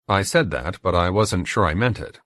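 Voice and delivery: plain reading voice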